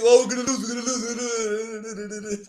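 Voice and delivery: slow, drawn-out mock moaning; mocking whine. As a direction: whine it like a doom-monger. Mocking friend's pessimism.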